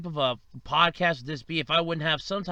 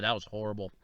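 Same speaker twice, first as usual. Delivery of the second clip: ordinary talk, nothing marked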